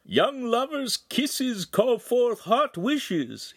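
put-on very high, slow old man's voice; old wagon driver. A narrator's reading. Old driver calls to young lovers in passing, high and slow. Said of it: "The driver was an old man".

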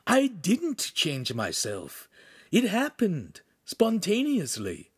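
high, slow, worried character voice; mildly anxious, drawn-out delivery. A narrator's reading. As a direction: stretch it out high with worry. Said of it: "his eyes filled with worry".

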